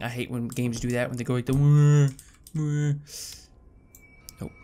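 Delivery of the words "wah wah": imitated drawn-out game moan; mock moaning. Player mimics the moaning games do, mock moan. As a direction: imitate a creepy moaning noise. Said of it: "Please don't do that moaning thing".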